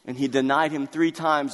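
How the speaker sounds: normal talking voice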